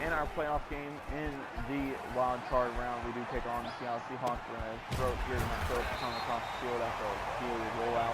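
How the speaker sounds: play-by-play commentary. Acted. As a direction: call the action like a broadcaster. Live play-by-play of own game.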